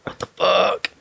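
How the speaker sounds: panicked what the fuck at the sight of blood; shocked outburst. Retold or imitated. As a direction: blurt it in sudden shock. Shock at bleeding hand.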